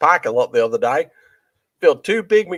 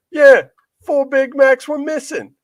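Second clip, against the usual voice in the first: high, slow Australian complaint; Australian accent; 12 semitones higher, 4 dB louder, slower than usual. Retold or imitated. Comedian claiming missing burgers, slow Australian accent. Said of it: "good Australian accent".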